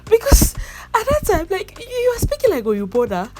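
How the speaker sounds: high, laughing through the words; still laughing. Real, not acted. Vlogger laughing while explaining the accent. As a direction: keep the giggle going.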